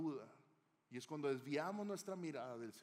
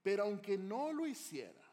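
louder, firm and higher; emphatic defiance; 5 semitones higher, 7 dB louder than usual. Acted. Three young men defying the king, firm and emphatic. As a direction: plant the words with resolve. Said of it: "fíjate cómo le dice".

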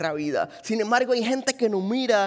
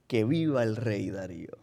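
low fawning officials; 11 semitones lower, 6 dB quieter than usual. Retold or imitated. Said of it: "ante el rey y dijeron".

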